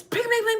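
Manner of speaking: high, bright bling bling of falling plates; imitated dish crash. Telling the listener what the crash sounded like, high ringing mimic. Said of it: "this loud noise".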